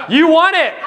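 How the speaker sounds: loud, high-pitched cheer for a winner; excited congratulations. Real, not acted. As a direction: celebrate their win, big and ringing. Audience member finds winning signed book.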